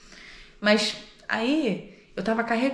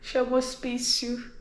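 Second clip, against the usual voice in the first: ordinary delivery, nothing marked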